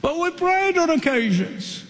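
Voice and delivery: high, whiny plea at the shut door; pleading foolish ones. Acted. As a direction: beg to be let in, pitched high.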